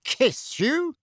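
high, rising, scandalised question; incredulous echo. A narrator's reading. Uncle still cannot grasp Harry's answer, incredulous. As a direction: swoop up high, unable to believe it. Uncle repeats words in disbelief.

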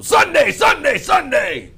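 booming high announcer shout; monster truck announcer. Retold or imitated. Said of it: "They always go".

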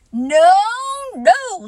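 loud, fussy insistence; a fussy, flustered no. A narrator's reading. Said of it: "getting quite fussy".

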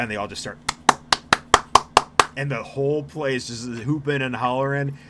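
normal talking voice

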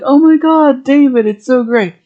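retold squeal, high and loud at the start; gushing. Jessica greeting her old flame with a loud high gush. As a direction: play her gushing over him, pitch jumping up.

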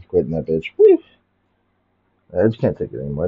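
ordinary delivery

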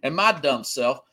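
normal talking voice

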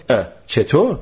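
plain reading voice

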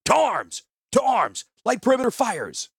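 high, loud rallying cry; shouted. A narrator's reading. Commander turning toward the camp to rouse it, high urgent shout. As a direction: shout the alarm across the camp. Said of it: "he shouted".

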